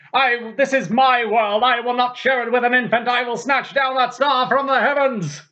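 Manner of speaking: high-pitched, theatrical tyrant's outburst; raging king's shout. Retold or imitated. Critic acts out Herod raging at a rival infant, shouting. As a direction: shout it like a paranoid king defying heaven. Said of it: "He starts shouting".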